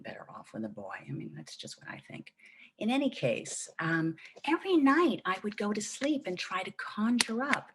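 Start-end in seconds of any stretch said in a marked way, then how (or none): none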